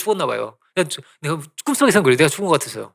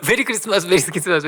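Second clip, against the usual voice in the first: teacher's cheery greeting; 2 semitones higher than usual. Retold or imitated. Teacher arriving in class, imitated cheery greeting. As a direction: imitate a teacher breezing in with hellos. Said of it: "이러면서 선생님이 오는데".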